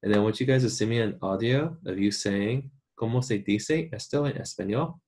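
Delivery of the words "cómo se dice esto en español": ¿Cómo se dice esto en español? with seseo, "se" and "dice" with s sounds; Latin American Spanish. Shown as a demonstration. Students asked to record it, Latin American Spanish accent. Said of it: "Or if you wanna do the Spain accent".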